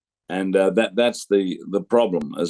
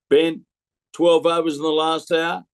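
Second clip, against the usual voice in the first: incredulous umpire question; 7 semitones higher than usual. Retold or imitated. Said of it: "Maria Erasmus said to me".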